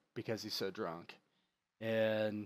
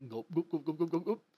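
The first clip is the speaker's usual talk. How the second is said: spoken gulp gulp gulp, rising as it goes; chugging the ale down greedily; mock gulping; 6 semitones higher than usual. Fern chugging his ale.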